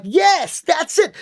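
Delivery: high-pitched delighted approval; excited coach. Retold or imitated. Coach cheering a student who found the character, excited.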